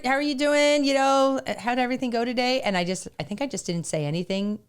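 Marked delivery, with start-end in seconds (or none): 0.0-2.6 cheerful husband greeting. Retold or imitated. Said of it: "and he's like".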